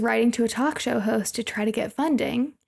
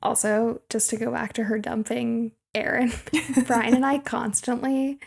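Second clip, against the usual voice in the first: ordinary talk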